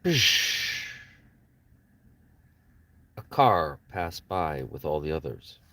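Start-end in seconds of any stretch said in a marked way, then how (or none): 0.0-0.9 a quick unvoiced psh of a passing car; car whoosh. Narrator imitating a car passing with a sharp psh. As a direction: spit out a short high hiss like a car going by. Car sound before car passes.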